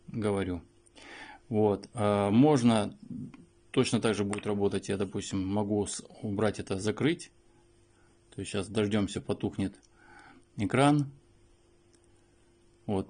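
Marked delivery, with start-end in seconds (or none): none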